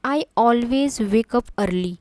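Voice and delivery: slow example read. Shown as a demonstration. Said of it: "teacher reads example slowly".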